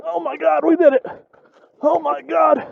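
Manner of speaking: high, thrilled disbelief; real joy at making it; excited. Real, not acted. Cleared the rock section.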